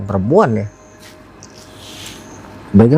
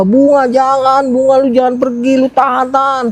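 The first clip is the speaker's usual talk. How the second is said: desperate pleading cry; 11 semitones higher than usual. Retold or imitated. Friends begging girl not to go.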